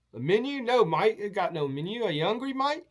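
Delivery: a friendly Aussie puzzled by the request; Australian host. Retold or imitated.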